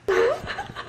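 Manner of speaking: high shocked laugh; disbelieving laugh. Real, not acted. Reacting to hard chapter.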